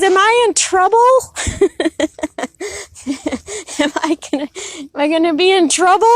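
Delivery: put-on high, loud, laughing old-lady voice; old lady worried. Grandma Ruth asking the neighbor if she's in trouble. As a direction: play her as sheepish and giggly. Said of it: "she goes".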